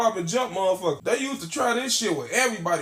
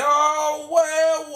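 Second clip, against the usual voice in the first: high, louder drawn-out villain gloat; 6 semitones higher, 3 dB louder than usual. Retold or imitated. Holding Trouble at gunpoint, gloating high and loud. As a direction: savor catching your enemy.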